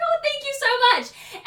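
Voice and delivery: high-pitched imitation of a thrilled girl; light up with grateful delight; overjoyed, gushing retold thanks. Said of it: "this girl lit up. She was so happy".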